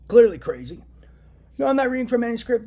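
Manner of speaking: sudden loud angry burst; angry outburst. Real, not acted. Ranting vlogger bursts out loudly at viewers. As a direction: snap loudly, fed up.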